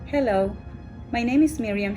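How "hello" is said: usual speaking voice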